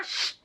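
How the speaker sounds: soft hushing sh; quiet drawn-out hush; shushing sound. Shown as a demonstration. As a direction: hush like asking for silence. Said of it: "she de sh".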